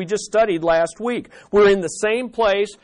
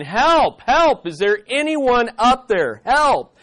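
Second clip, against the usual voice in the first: high, raised calls for help; desperate, hammed-up yell for rescue; shouting for help; 5 semitones higher, 4 dB louder, slower than usual. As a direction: call up the cliff for anyone to hear. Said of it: "he starts shouting".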